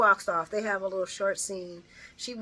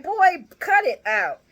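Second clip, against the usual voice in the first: exasperated scolding; 9 semitones higher, 9 dB louder, slower than usual. Retold or imitated. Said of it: "Willow is like".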